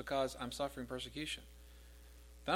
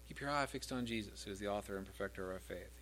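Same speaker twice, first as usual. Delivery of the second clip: normal talking voice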